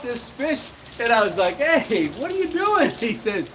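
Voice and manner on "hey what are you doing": high-pitched, laughing-edged hey what are you doing; surprised protest. Retold or imitated. Protesting to father-in-law tossing fish, high and surprised. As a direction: squeak out a startled objection.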